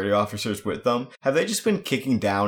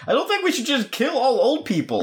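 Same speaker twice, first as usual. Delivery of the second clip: said through a laugh. Real, not acted. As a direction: giggle the words out.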